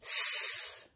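S as a long voiceless hiss; phonics sound demo. Shown as a demonstration. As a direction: hold the sound of "S" as pure sss. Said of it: "first sound you can hear".

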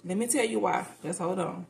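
ordinary delivery

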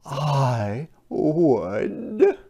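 lightly voiced little fish; a hint of a small fish; lanternfish voice. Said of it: "replied one lanternfish".

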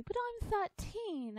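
high, slow, indignant protest; girl objecting to being babysat. Acted. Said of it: "Alice protested".

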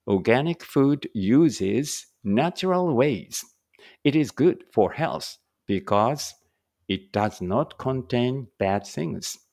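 slow, careful English reading for learners; slow read-aloud. Shown as a demonstration. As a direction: read each word out slowly and clearly. Reads English passage slowly. (ゆっくり丁寧な英語の音読)

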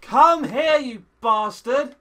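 chasing shout; angry yell. Real, not acted. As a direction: bellow at the fleeing mob. Chasing the ghast.

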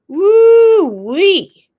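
high, loud woo-wee; excited whoop. Real, not acted. Exclaims about snow.

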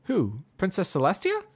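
high, loud, musing pony; mare voice, considering. Acted. Pony asks.

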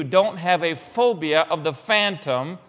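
normal talking voice

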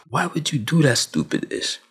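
ordinary talk

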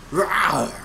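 growled rawr in a put-on voice; mock growling roar; Snake growl. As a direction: growl it out like a game hero. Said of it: "That's my Snake voice".

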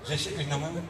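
low, quiet daughter voice on the phone; hushed daughter. Acted. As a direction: speak softly so the mother-in-law won't hear. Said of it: "ધીમું બોલું". (સાસુ ન સાંભળે એમ દીકરીનો ધીમો અવાજ)

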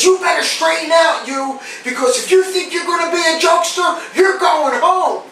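high, stern imitated dressing-down; military voice. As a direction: lay down the law like a drill sergeant. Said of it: "you know how the military is".

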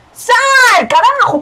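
high, stretched curse; angry yell. Real, not acted. Player cursing a rival in game, angry yell. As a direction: yell them out of the way.

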